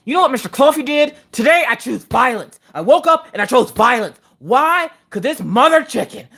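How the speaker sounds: high, heated mock tirade; indignant venting, hammed up; worked-up rant. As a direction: launch into a high-pitched acted-out outrage. Acting out her furious rant.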